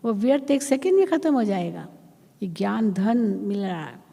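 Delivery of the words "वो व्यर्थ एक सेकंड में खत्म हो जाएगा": high, fast, forceful; emphatic preaching. Real, not acted. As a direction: drive the point home with fervour. (ऊँचे स्वर में ज़ोरदार उपदेश)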